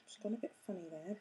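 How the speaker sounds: fast unvoiced whisper to herself; quick whisper. Real, not acted. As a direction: rush it under your breath. Muttering over fiddly work.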